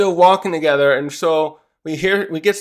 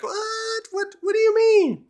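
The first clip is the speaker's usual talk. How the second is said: very high, squeaky disbelief; shocked kids. Retold or imitated. Whole group of kids reacting, high squeaky shock. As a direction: squeak it out like scared cartoon kids.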